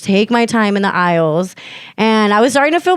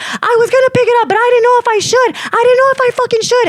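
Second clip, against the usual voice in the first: fast, high-pitched indignant protest; angry retort; 13 semitones higher, faster than usual. Retold or imitated. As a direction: argue back in a rush, pitched way up. Acts out her side of quarrel.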